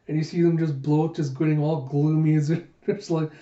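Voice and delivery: ordinary delivery, nothing marked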